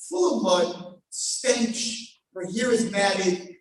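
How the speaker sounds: usual speaking voice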